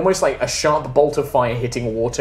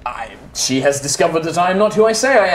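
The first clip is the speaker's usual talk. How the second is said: urgent woman; 4 semitones higher, 3 dB louder than usual. Acted. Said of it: "you hear Lilith in your mind say".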